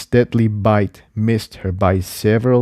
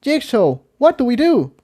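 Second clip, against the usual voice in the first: much higher voice, urgent; calling to Jigsaw; 13 semitones higher than usual. Acted. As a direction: ask for help quickly.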